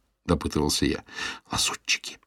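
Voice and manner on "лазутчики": hushed conspiratorial whisper; even quieter whisper. A narrator's reading. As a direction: drop to a secretive hush. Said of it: "произнесла она ещё тише".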